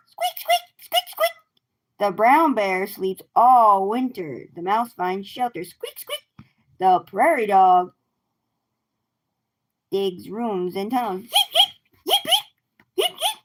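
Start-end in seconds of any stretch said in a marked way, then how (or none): 0.1-1.3 very high tiny mouse squeaks; squeaky mouse. Pretend. Reading to kids, the mouse squeaks high. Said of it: "the mouse goes". 5.6-6.3 very high, soft squeaking; tiny mouse squeak, barely there. Pretend. Reading to kids, a hushed high mouse squeak. 11.2-13.4 very high yipping calls; prairie dog yips. Pretend. Reading to kids, the prairie dog yips high.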